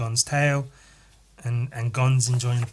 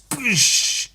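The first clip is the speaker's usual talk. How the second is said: high explosive boosh; crashing sound effect; 8 semitones higher than usual. Retold or imitated. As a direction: blast it out as an impact noise. Imitating smashing through rocks.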